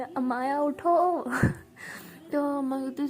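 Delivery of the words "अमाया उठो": slow, loud stretched call; drawn-out wake call. Acted. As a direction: drag out her name to wake her. Calling to wake her. (धीमे खिंचे सुर में ज़ोर से पुकारना)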